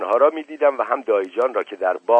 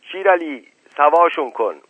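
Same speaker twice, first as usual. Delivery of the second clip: loud, high shout across the yard; 6 semitones higher, 7 dB louder than usual. Acted. Dayi Jan shouts to Shir Ali to separate them, loud call. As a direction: call out to stop the brawl. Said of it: "فریاد زد".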